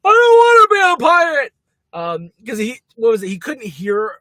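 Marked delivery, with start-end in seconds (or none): 0.0-1.5 high, loud wailed protest; Jerry Seinfeld. Acted. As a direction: shout it in a much higher, whiny pitch. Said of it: "the, the Jerry's".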